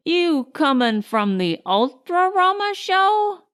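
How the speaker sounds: high, chatty cabbie voice; friendly driver making small talk. A narrator's reading. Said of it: "the driver asked".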